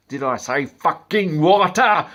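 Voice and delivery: loud, slow, high strangled yell; Homer-style strangling rage; furious shout. Pretend. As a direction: throttle someone as you shout it. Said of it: "I just feel like".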